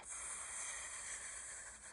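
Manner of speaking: hissed, soft and slow; snake hiss. Shown as a demonstration. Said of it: "a bit like a snake".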